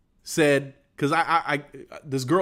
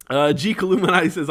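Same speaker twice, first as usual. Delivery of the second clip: normal talking voice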